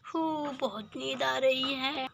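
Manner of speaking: soft, drowsy yawn into the words; sleepy. Acted. Character settling on the sofa for the night, sleepy. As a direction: say it quietly as sleep takes over.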